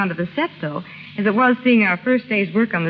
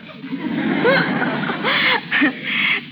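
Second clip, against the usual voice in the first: amused laugh. Real, not acted. Guest laughs at host's joke.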